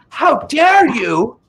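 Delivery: high, slow, loud outraged cry; Greta impression. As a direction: shriek it like an outraged activist. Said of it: "the media is like".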